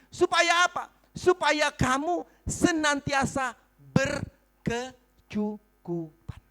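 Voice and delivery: loud, forceful preaching; khotbah berapi-api. Real, not acted. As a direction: preach it out to the whole room, stretching key words. (berkhotbah lantang dan berapi-api)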